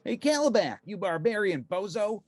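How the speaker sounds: high, mocking hero taunt; cocky hero taunting a villain; taunting call. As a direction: tease the brute from the sky. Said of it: "Firestorm called out".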